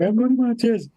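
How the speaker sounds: put-on high, strained kid owning up; panicky confession. Boy owning up to his old man, mock panicky. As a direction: blurt it out like a cornered child.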